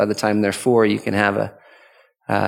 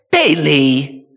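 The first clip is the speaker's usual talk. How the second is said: sharp, higher mean call; 4 semitones higher than usual. Shown as a demonstration. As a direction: call the name out meanly. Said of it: "does that sound mean".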